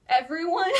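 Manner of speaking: loud, high, half-laughing question; loud incredulous yelp; retold incredulity. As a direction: blurt it out in mock disbelief. Retold shock at the threat.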